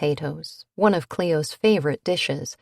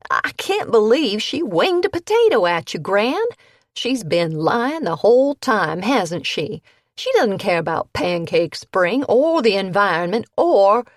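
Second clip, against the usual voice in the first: higher, louder angry outburst; furious grandson; 4 semitones higher, 5 dB louder than usual. A narrator's reading. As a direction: rage at the betrayal.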